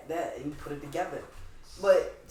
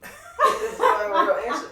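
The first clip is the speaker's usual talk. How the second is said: words through high laughter; laughing; 10 dB louder, slower than usual. Real, not acted. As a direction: laugh while saying it. Laughing at answer.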